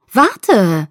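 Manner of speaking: loud call after him. A narrator's reading. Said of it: "rief ich". (laut rufend)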